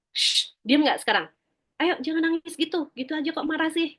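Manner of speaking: acted parental telling-off; repressing parent. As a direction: hush a crying child impatiently. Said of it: "Yang pertama adalah repressing".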